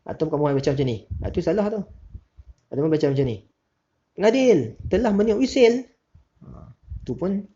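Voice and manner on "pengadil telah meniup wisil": "Pengadil telah meniup wisil" read much higher and louder as an exclamation; wrong exclamatory intonation; wrong exclamation tune. Shown as a demonstration. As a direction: exclaim it, pitch up hard. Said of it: "tu ayat seruan".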